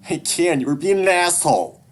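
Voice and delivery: put-on high, loud angry kid; furious high-pitched outburst; cracking yell. A narrator's reading. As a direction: yell back at your dad till the voice cracks. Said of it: "I yell so loud my voice cracks".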